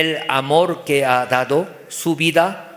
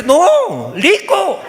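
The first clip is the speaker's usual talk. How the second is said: high, loud exasperated father; heated correction, L for R; dad correcting; 12 semitones higher, 5 dB louder, much slower than usual. Acted. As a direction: insist on "lico" as if it were right. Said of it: "Papá dice".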